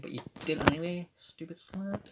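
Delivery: high, soft sneer of a teacher; lightly voiced teacher, mock disdain; imitating the head of year, high and soft. Vice principal voiced in story.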